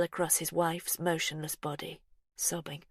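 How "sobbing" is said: plain reading voice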